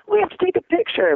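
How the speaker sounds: imitating a starstruck fan, very high-pitched; high, eager mock fan plea; excited fan. Retold fan wanting a photo.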